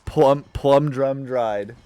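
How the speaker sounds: slow, loud, drawn-out read; slow emphatic. Pretend. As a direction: stretch each word out loud.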